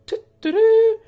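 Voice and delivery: very high, loud cutesy chirp; Mayuri's catchphrase. Acted. Bubbly girl's signature catchphrase, very high and loud. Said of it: "is Mayuri's versatile catchphrase".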